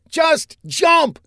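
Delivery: very high yelled command; urgent shout. A narrator's reading. Saffinger commands the woman to leap, shouting. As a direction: yell it as a last urgent push. Ordering her to jump.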